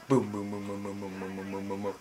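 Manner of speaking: low, soft run of booms; quiet monotone boom-boom-boom; imitated gunfire. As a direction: murmur rapid booms on one low note.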